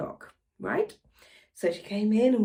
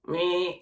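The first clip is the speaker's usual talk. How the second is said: short droning buzzer noise; imitated door buzzer; 3 semitones lower than usual. Telling how the bell went at two, mimicked buzz. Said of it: "the buzzer to ring".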